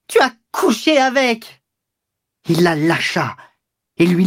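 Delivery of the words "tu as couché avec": very high-pitched strained yell; howling. A narrator's reading. Dishevelled mistress shaken by her lover, howling at him. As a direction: howl the accusation in a high, wild voice. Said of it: "Elle hurla".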